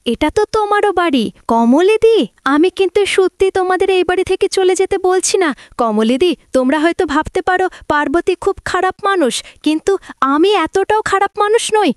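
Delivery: lightly voiced hurt young wife pleading; soft, lifted voice of a wounded bride; Parbati voiced.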